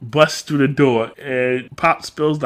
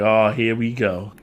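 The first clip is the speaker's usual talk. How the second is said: knowing groan; 7 semitones lower, slower than usual. Retold or imitated. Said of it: "I was like".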